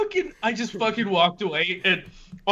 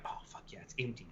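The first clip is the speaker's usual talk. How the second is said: barely voiced, much softer aside; hushed private thought; 8 semitones lower, 19 dB quieter, faster than usual. Customer spotting an empty post office, hushed to himself. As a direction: murmur it secretly to yourself.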